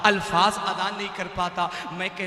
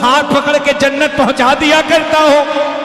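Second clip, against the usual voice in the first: loud, raised religious oratory; full-force preaching; 6 semitones higher, 17 dB louder than usual. Acted. Preacher voicing the Prophet's promise to the faithful, loud proclamation. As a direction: proclaim it to the whole crowd.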